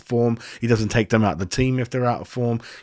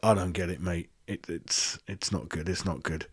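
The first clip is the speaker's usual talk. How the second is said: much softer, lower, defeated murmur; deflated; 6 semitones lower, 10 dB quieter than usual. Real, not acted. Fan giving up on his team's form, quiet and defeated. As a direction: let it drop away, beaten. Dejected after the rant.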